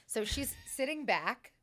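ordinary delivery

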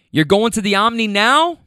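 lightly acted man checking plans by phone. Friend on phone with drunk women.

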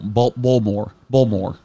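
put-on a light Baltimore accent, Baltimore said as "Balmore" with the t dropped; lightly mock the local Baltimore way of saying Baltimore. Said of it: "it's almost like, you know, Baltimore".

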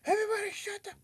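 very high-pitched mock order; Kilgrave's command. Villain ordering a whole coffee shop around, mock commanding. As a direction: imitate a commanding outburst without extra volume. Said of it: "he's like, tells everybody".

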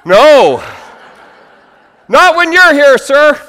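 booming, high exaggerated protest; hammed-up loud assurance; mock shouted reply. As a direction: bellow it like an overeager congregant.

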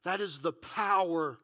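low, soft, measured pulpit voice; solemn scripture reading. Real, not acted. Preacher showing the Spirit's power from Job, hushed and solemn. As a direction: read it quietly, pausing between phrases.

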